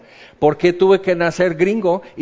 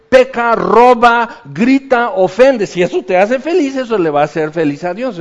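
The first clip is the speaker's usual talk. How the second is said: mock preacher exhortation; 6 semitones higher, 6 dB louder than usual. Preacher parodies feel-good sermons, loud mock exhortation. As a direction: bellow the sins like permission. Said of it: "Eso es lo que hoy se predica".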